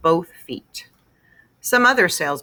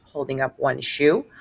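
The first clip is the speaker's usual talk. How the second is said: ordinary delivery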